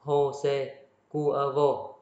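Jose Cuervo in Spanish, "ho-se kuer-vo", drawn out slowly; slow, careful "ho-se kuer-vo" at the end; Spanish pronunciation. Shown as a demonstration. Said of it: "Tên này tên Mexico".

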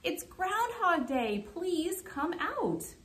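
high, drawn-out crowd call; mild read-aloud shout; storybook shout. A narrator's reading. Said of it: "added with a shout".

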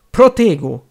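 Harry shouts the spell to block Snape; shouted spell. Acted. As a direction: fling the spell out in a loud cry. Harry casts a shield spell.

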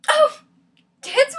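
high, loud mock exclamation; exaggerated high-pitched jeer; mocking cry. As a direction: throw the pitch way up, loudly mimicking a tormentor. Mocking quote of cruel friend.

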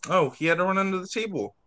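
ordinary delivery, nothing marked

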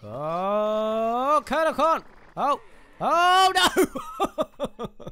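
high, loud drawn-out cries; alarmed shouting. Real, not acted. Player running into Kernel Corn, shouting in alarm. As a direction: holler in startled dismay. Sudden enemy attack in game.

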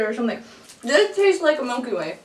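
talking behind hand; chewing while talking. Real, not acted. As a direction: mouth partly full. Seen 1: Left speaker chews behind hand, picking at small sweet throughout.

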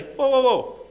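high, drawn-out dismissal; a stern judge waving them off; a hint of the Lord turning someone away; the Lord rejecting. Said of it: "And he'll say".

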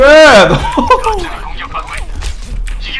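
high burst of nervous laughter; startled laugh. Real, not acted. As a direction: laugh off the fright. Laughing after scare.

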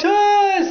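high loud yell; cry out. A narrator's reading. Riders crying out on the drop. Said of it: "as they cried out".

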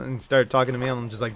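ordinary talk

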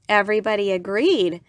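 usual speaking voice

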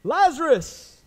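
huge high commanding shout; booming yell, pitch way up. Acted. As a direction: call the dead out of the tomb. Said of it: "the Lord yelling out".

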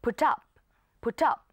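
"put up" as one word, "pu-tup"; put up linked. Shown as a demonstration. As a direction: slide the T of "put" into "up". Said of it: "ลากเสียง T มาหา O ด้วย". (อ่าน "put up" ลากเสียง T ไปหา up)

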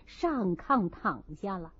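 ordinary delivery

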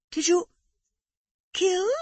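nervous wife fearing the answer; Amanda anxious. A narrator's reading. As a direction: ask with dread, pitched very high.

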